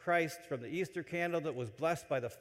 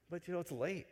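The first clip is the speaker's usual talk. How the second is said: normal talking voice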